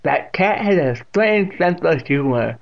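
put-on loud, high, slow old woman; deaf old aunt, amused. A narrator's reading. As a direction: relish it loudly and slowly.

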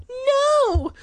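high, loud terrified scream. A narrator's reading. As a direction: shriek it in terror. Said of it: "Sherry cried out".

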